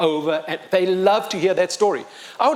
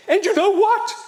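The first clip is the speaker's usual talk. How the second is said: sudden burst; 13 semitones higher, 3 dB louder than usual. Retold or imitated. Sudden announcement to kids.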